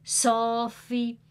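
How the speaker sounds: usual speaking voice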